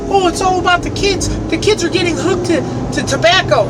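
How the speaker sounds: high, mocking whiny voice of anti-vape groups; mocking quote. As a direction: parody their fake concern for the kids. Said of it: "And they use the kids, like".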